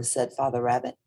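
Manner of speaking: plain reading voice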